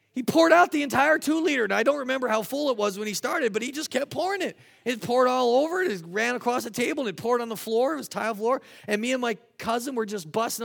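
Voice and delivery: building excitement as the mess spreads; excited. Real, not acted.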